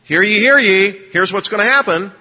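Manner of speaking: loud, high proclamation to the town; king's herald announcing. Retold or imitated. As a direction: proclaim the decree to the crowd. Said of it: "one of the king's men would have to stand up".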